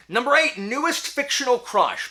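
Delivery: big, loud countdown call; loud announcement. Real, not acted. Vlogger announcing the next category, loud and high. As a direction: boom out the category like a show host.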